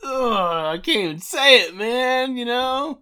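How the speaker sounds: high, loud exasperated whine; annoyed ugh. Acted. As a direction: groan it out, fed up and frustrated. Exasperated groan at the show intro.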